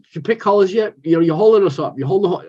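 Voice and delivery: retold impatient badgering; outspoken retold demand. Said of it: "that's how I talk to him".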